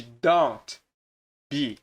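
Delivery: "don't" with a sounded T, a gap, then "be"; fully pronounced T. Shown as a demonstration. Teacher contrasting with the spoken form, T sounded in "don't". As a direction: separate "don't" and "be", keeping the T. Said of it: "ao invés de".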